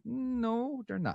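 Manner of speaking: long, high sing-song no; mock contradiction. Correcting a trucking company's safety boast, sing-song contradiction. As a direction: draw out a high, knowing denial.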